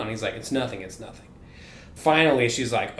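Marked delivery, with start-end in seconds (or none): 0.3-1.3 soft, mildly glum brush-off; imitated mopey. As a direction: act mopey and mumble it away quietly. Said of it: "he's just mopey as fuck".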